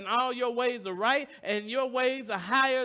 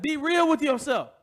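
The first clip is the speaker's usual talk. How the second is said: louder, higher call of "Be real with yourself" with "real" hit hardest; loud quoted exhortation; 4 semitones higher, 7 dB louder than usual. Retold or imitated. Preacher quoting someone's advice, calling it out loud. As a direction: fling out "real" like a quoted shout. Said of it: "Somebody said".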